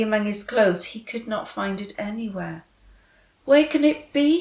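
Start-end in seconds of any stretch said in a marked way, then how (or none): 3.4-4.4 worried. Acted.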